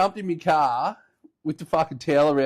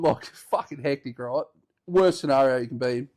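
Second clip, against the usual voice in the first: ordinary talk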